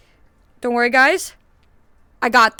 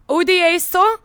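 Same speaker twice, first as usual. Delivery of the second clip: ordinary talk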